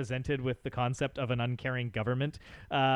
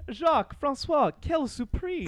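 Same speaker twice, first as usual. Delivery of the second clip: Buddy Cole greeting; 11 semitones higher, 4 dB louder than usual. Retold or imitated. Fan quoting a favourite line, in an arch high put-on voice. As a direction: greet them grandly, pitched way up and savoured. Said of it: "Buddy greeting them with".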